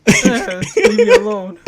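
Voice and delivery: put-on high, loud, laughing old man; old guy voice. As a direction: croak like a grumpy old man. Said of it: "just the fucking old guy voice".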